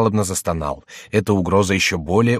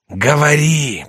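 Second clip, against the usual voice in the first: high, loud drawn-out command; mysterious voice; 7 semitones higher, 6 dB louder, much slower than usual. A narrator's reading. Mysterious voice demands the confession, loud and commanding. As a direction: boom the order from on high. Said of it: "произнёс голос".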